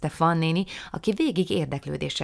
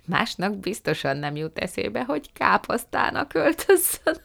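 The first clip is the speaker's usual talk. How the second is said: chuckling; 5 semitones higher, 4 dB louder than usual. A narrator's reading. Fan néni pictures the cabbage costume, chuckling. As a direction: voice climbing high into a giggle. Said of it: "kuncogott Fan néni".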